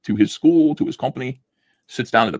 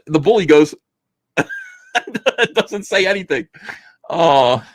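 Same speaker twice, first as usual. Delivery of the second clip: ordinary talk, nothing marked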